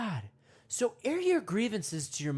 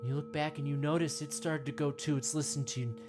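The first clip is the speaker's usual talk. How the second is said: guided meditation; 5 semitones lower than usual. Acted. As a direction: ease the listener in gently, mock-serene.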